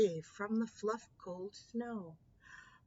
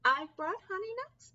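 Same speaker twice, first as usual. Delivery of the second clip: higher grinning badger voice; 9 semitones higher, 4 dB louder, faster than usual. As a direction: offer the nuts with a grin. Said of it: "Badger says with a grin".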